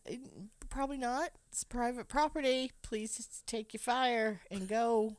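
mock polite plea. Mock plea to the trespassing woman.

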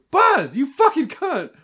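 loud, slow mock Woody yell; hammed-up Woody snapping at Buzz; Tom Hanks as Woody cussing. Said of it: "just quotes Disney movies that he's been on and just throws cuss words".

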